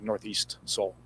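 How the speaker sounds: usual speaking voice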